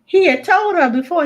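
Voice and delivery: ordinary talk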